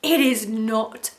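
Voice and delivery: slow, punched-out mock outrage; angry retort. Erica snapping at her ex-husband, mock angry. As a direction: spit it out at him. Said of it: "And she's like".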